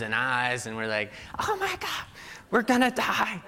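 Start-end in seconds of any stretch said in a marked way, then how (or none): 1.4-3.4 put-on high-pitched panicked kid; mock panic. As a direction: imitate terrified little boys, squealing high. Scared children see a monster.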